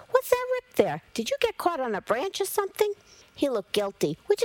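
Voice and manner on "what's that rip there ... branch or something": fussing alarm. Acted. Mother scolding son over torn clothes.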